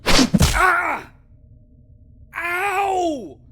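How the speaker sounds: sudden high yelp of pain, loud; pained cry. Acted. Necromancer hit by an adventurer, pained yelp. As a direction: cry out as the blow lands. Struck by the intruder.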